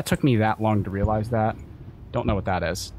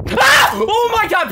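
high, loud shriek of fright; startled scream; 10 dB louder, slower than usual. Real, not acted. Streamer jump-scared by the game, startled scream. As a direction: scream out in sudden shock.

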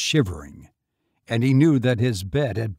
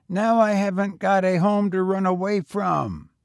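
put-on higher, eager boy voice; young boy; 8 semitones higher, 4 dB louder than usual. A narrator's reading. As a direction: pitch up for a street boy. Boy talks.

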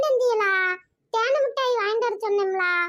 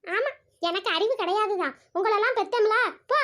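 normal talking voice

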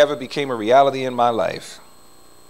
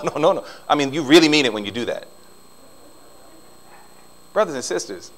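ordinary talk, nothing marked

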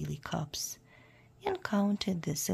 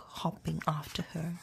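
ordinary delivery

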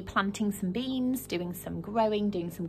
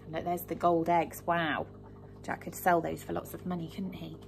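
ordinary delivery

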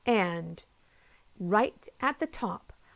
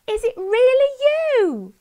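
joyful shout; 11 dB louder than usual. A narrator's reading. Polly greets the boy on Jake with joy. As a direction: cry out his name in pure joy.

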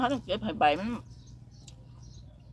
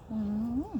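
ordinary talk, nothing marked

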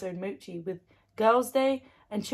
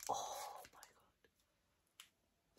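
breathless unvoiced whisper, much softer; awed whisper; 18 dB quieter than usual. Real, not acted. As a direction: let it out in a hushed, voiceless gasp of wonder. Touches the soft mochi.

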